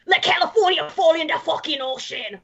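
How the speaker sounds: mock the singer's aggressive outburst; half-shouted punk rant, retold. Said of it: "like, really aggressively over and over again".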